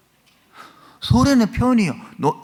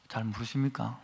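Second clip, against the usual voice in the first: quiet, falling rhetorical question to the pews; soft question; 5 semitones lower, 12 dB quieter than usual. Real, not acted. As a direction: ask it softly, almost to yourself. Preacher asks congregation rhetorically.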